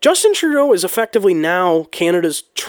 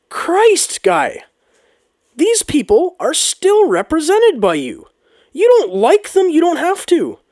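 exasperated outburst; 10 semitones higher, slower than usual. Real, not acted. Ranting at the prime minister.

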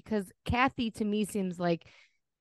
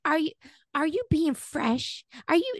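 imitating Kathy; 7 semitones higher than usual. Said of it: "the way she's like".

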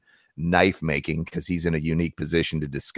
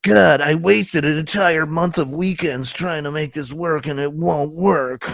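high, drawn-out mock-teenager whine; teenage voice; 9 semitones higher, slower than usual. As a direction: mimic an aggrieved customer as a whining teen. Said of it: "I need that teenage voice now".

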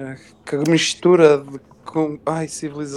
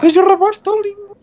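put-on high, loud girly gush; high swooning voice; 8 dB louder than usual. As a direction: swoon over the boy.

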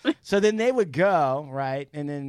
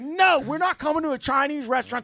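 higher, bossy dad impression; raised, hammed-up father voice; mock stern dad; 10 semitones higher, faster than usual. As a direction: lay down the law like a pushy father. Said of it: "And my dad would be like".

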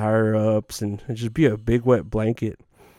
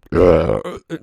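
loud throaty gag and splutter; imitated choking; 7 semitones lower, 4 dB louder than usual. Comedian acts out coworker spitting into the sink, mock choking. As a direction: mimic someone hacking up a bad drink. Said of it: "gasping from the back like she was choking".

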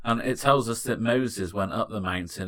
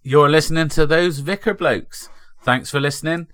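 podcast announcer; 3 semitones higher, 4 dB louder than usual. Acted. Podcast outro read.

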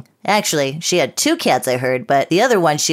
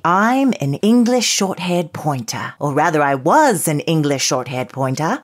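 put-on English accent. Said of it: "With this stupid accent".

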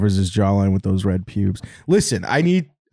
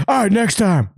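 high-pitched mock giving up; lightly voiced quitter, pitched high; imitated video guy; 10 semitones higher than usual. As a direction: bail out in a pitched-up voice. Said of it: "And he goes".

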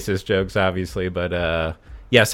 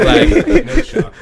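mock shocked; 4 dB louder, slower than usual. Mock outburst.

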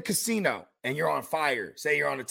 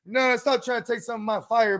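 ordinary delivery

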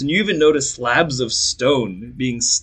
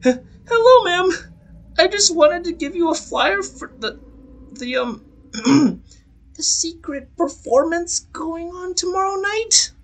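put-on much higher, stammering young boy; timid messenger boy, halting; nervous boy voice. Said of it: "and he speaks".

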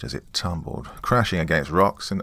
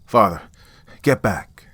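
urgent shout; 3 semitones higher, 6 dB louder than usual. A narrator's reading. Warning priest at cliff edge.

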